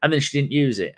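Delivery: ordinary delivery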